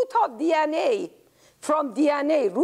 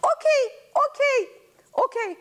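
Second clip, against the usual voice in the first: flustered; 13 semitones higher, faster than usual. Acted. Grandma flustered.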